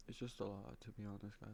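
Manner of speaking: whispered, trailing off; whispering. Real, not acted. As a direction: drop to a whisper.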